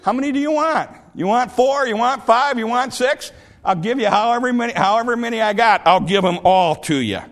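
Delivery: fed-up man offering everything; exasperated giving in. Retold or imitated. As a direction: throw bread at him to make it stop. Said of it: "he says".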